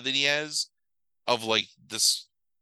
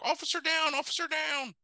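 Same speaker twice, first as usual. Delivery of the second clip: high, thin call of officer down; radio call; 4 dB quieter than usual. Retold or imitated. Retelling the ending, an officer calls over the radio. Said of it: "And it's like".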